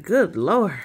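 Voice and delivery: mild mock alarm of a mother; worried mom. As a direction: call out concerned, like mom. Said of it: "She's like".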